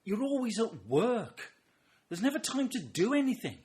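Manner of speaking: high, loud nagging voice; complaining wife. Acted. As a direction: imitate a wife's exasperated complaint. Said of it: "My wife was always saying".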